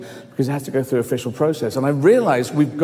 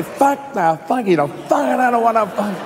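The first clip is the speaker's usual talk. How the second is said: high, slurred-together swearing; hammed-up sweary comeback; mock slanging match; 6 semitones higher than usual. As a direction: rant back in comic nonsense abuse. Acts own side of slanging match.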